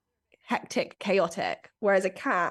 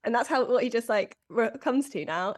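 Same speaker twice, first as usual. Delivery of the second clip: ordinary talk, nothing marked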